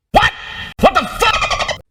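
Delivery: high screamed disbelief, last word drawn out; piercing hammed-up shriek of astonishment; shocked scream. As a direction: scream it in over-the-top amazement. Acting out astonished reaction to film.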